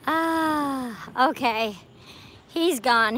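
usual speaking voice

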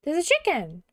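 high, loud, hammed-up funny voice; funny theatrical character. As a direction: ham it up loud and high, like a stage adventurer. Plays a funny theatrical character in game.

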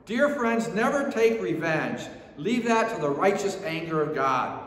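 ordinary talk, nothing marked

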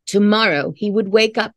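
ordinary delivery

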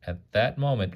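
ordinary delivery, nothing marked

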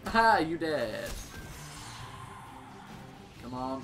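usual speaking voice

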